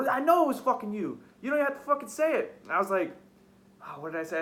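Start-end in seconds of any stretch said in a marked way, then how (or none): 0.1-2.6 higher-pitched, irritated accusing; retold angry accusation. Retelling accusing her of slamming the door, angry. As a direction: re-enact the confrontation, voice raised in pitch.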